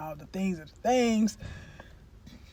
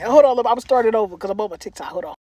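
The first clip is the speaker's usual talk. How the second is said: ordinary delivery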